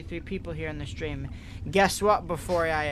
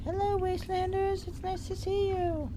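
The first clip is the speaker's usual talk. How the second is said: very high, cutesy talking-dog voice; high sweet doggy greeting to the fans; puppy voice. Pretend. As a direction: squeak it out as the little puppy saying hello. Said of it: "She says hi to all you Wastelanders".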